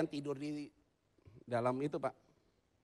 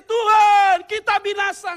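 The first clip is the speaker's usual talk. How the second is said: loud, high cry to the Lord; high-pitched desperate shout; panicked cry for help; 16 dB louder, slower than usual. Retold or imitated. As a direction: call out as if about to perish. Disciples crying out to Jesus in panic.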